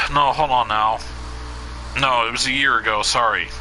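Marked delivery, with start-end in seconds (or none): none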